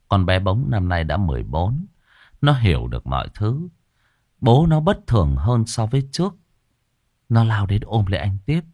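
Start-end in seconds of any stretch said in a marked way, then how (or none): none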